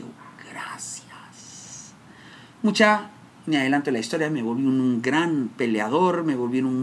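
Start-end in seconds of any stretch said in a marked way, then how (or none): 0.0-1.9 very slow whispered prayer of thanks. Retold or imitated. Son thanking God in a whisper. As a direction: breathe a thank-you upward. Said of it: "le di gracias a Dios y dije".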